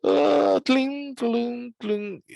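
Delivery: high plinks fading down; piano plink imitation. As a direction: tinkle a descending piano run. Mimicking piano notes.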